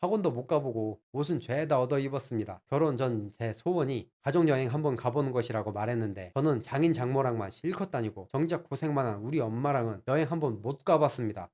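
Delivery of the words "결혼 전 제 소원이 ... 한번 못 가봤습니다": plain reading voice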